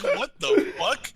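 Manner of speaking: slow, high, baffled mock reaction; stunned. Two friends stopping short in shock at the name, slow and high. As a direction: drag it out in disbelief.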